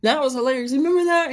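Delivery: high, loud nostalgic amusement; amused looking back. Retold or imitated. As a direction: laugh back at the old memory, excited. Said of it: "you're like".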